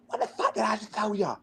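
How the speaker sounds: angry coach. Retold or imitated. Coach scolding player for the risky pass, high mock rage. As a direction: shriek it like an outraged coach. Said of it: "He's like".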